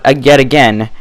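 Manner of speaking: ordinary delivery, nothing marked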